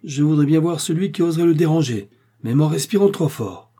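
high, loud, indignant threat; formidable wife laying down the law; fierce warning. A narrator's reading. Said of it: "d'un air terrible".

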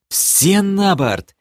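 hoarse command, pitched high and slow; сиплый приказ. A narrator's reading. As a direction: call all aboard with a husky edge. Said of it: "сипло велел я". (сипло командовать, протяжно и высоко)

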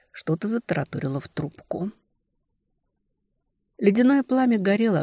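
plain reading voice

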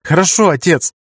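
high, loud happy cry; joyful exclaim. A narrator's reading. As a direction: shout it out with joy. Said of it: "радостно воскликнул Бер".

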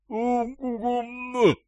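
long, very high muffled moan; pained moan. A narrator's reading. Gagged captive takes blows to the body, high muffled moan. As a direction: hold a gagged moan of pain. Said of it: "крайне болезненных ударов".

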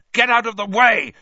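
loud, high warning shout. A narrator's reading. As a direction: yell them out of the way. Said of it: "he shouted at Ron and Hermione".

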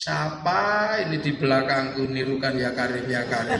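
low grumbling aside; suspicious grumble. Acted. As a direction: voice the irritated pilgrim under his chant. Pilgrim grumbles about being copied.

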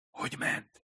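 discreet whispered question. A narrator's reading. Hirai leans over the counter to Nagare, whispering. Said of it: "súgta oda Nagarénak".

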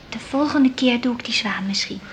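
unvoiced whisper. [name] whispering to her mother after playing. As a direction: say it under your breath, cheekily.

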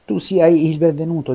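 high, loud royal greeting; king's welcome. Acted. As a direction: welcome him grandly as a king. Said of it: "disse il re". (il re accoglie il ragazzo, voce solenne e alta)

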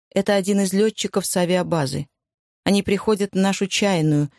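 ordinary delivery, nothing marked

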